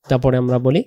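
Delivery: ordinary talk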